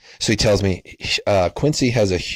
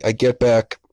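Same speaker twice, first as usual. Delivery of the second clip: normal talking voice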